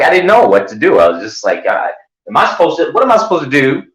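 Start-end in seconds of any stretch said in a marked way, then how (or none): 2.3-3.8 high-pitched, mock-desperate questioning; hammed-up high bewilderment; helpless confusion. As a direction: act out being totally at a loss. Acts out his helpless confusion.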